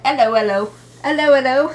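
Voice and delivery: put-on playful, loud British-accented greeting. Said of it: "That looks like a British accent".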